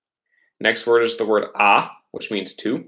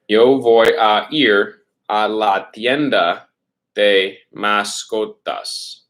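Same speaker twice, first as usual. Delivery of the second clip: slow dictation; slower than usual. Shown as a demonstration. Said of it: "teacher dictates full sentence slowly".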